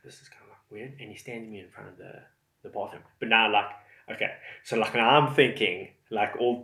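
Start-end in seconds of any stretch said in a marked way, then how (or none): 0.0-1.0 whispered nervous thought; worried whisper to self; hushed inner thought. Retold or imitated. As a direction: breathe it under your breath. Secret worried thought inside.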